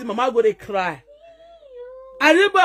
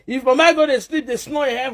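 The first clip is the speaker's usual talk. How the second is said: ordinary talk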